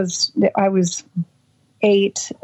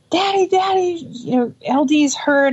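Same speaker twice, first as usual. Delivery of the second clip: put-on much higher frantic child cry; retold screaming; 8 semitones higher than usual. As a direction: call for daddy in a panic. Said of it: "screaming at my dad".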